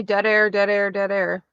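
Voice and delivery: ordinary talk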